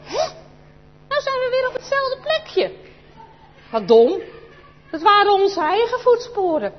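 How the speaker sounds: put-on high, loud child's dismay at their own footprints; a kid groaning at a silly mistake, pitched high and loud; surprised child. Child to friend, loud high dismay at own footprints. Child realises they walked circle.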